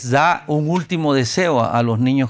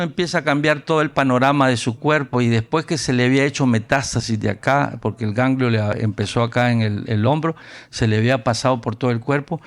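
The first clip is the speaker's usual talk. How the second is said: normal talking voice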